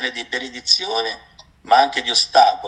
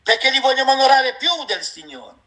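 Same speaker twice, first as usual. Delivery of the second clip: high, ringing sermon delivery, pressing every phrase; preaching at full force; 8 semitones higher than usual. Real, not acted. Preacher warning the congregation about God's word, high and forceful. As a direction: thunder the warning at the brothers and sisters.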